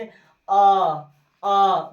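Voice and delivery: अ held long, like a drawn-out aa; long vowel. Shown as a demonstration. Teacher showing long vowels to students, अ drawn out. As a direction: stretch अ to double length. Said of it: "दो गुना समय लग जाता है".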